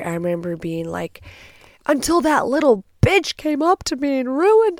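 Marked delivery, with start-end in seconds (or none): 1.9-4.8 high, loud acted outrage; retold angry rant. Victim complaining to police about her attacker, acted anger. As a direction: rant as if reliving the fury. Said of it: "I was so angry".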